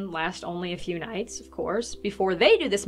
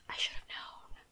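whispering; 15 dB quieter than usual. Retold or imitated. John's dawning realisation.

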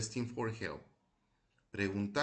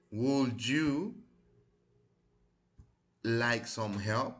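slow model reading; 3 dB louder, much slower than usual. Shown as a demonstration. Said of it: "vamos a repetirlas".

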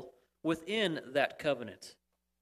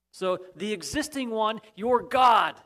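booming declaration; 4 semitones higher, 8 dB louder than usual. Acted. Preacher voicing God's declaration.

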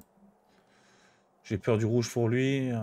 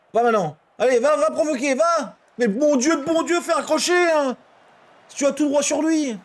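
high, loud frustrated yelling; yelling from the sidelines; 12 semitones higher, 4 dB louder than usual. Real, not acted. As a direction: shout orders at the screen. Yelling at players on screen.

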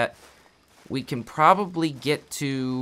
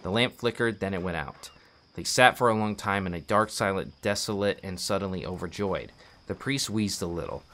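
measured book-reading voice, lower than chat; narrating a tragic Russian novel; story narration; 4 semitones lower than usual. Acted. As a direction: read the novel aloud like an audiobook. Reads a book aloud.

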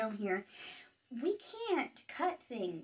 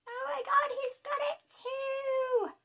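squeal of delight; 12 semitones higher, 4 dB louder than usual. Real, not acted. Finds gemstone on tiny troll.

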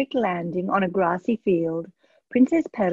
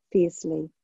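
plain reading voice

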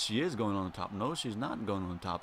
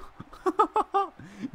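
high laugh; 4 dB louder than usual. Real, not acted. Streamer laughing at a wrestling move, high laugh.

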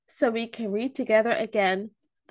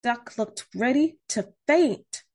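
plain reading voice

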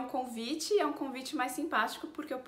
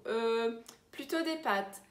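thinking it over; much slower than usual. Shown as a demonstration. Teacher answers a food invitation, thinking it over. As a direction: hesitate at length before deciding. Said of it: "responder pensando um pouco".

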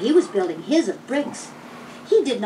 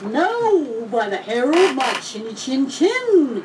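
roaring; 3 semitones higher, 3 dB louder, slower than usual. A narrator's reading. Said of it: "he roared".

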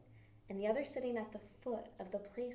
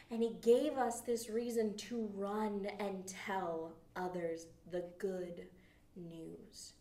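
usual speaking voice

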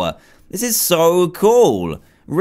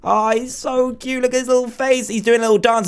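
cooing; 9 semitones higher than usual. Real, not acted. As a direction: melt over the little one. Cooing at baby gorilla.

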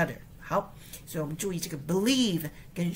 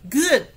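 "Good" high and loud, a quick falling exclamation; 响亮的 Good; emphatic approval; 10 semitones higher, 11 dB louder than usual. Shown as a demonstration. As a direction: bark "Good" as hearty praise. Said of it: "good是评量前面这句话说得好".

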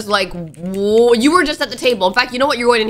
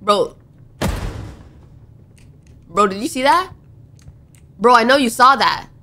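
normal talking voice

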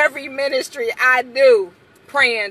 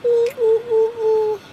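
high sing-song "ooh"s; playful high cooing; 13 semitones higher, 4 dB louder than usual. Real, not acted. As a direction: coo at the viewers in a high voice. High cooing greeting to viewers.

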